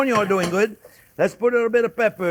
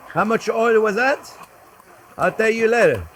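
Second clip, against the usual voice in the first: normal talking voice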